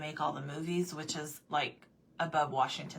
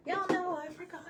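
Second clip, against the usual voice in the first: much higher, squeaky aside; self-mocking; 12 semitones higher than usual. Real, not acted. Telling viewers she forgot her paint, squeaky. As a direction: squeak it in mock self-blame.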